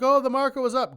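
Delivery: high, loud hyped-up crowd; mock excitement. Mimicking everyone's reaction to the market, mock excited.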